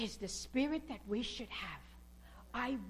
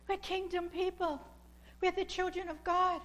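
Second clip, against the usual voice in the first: ordinary talk, nothing marked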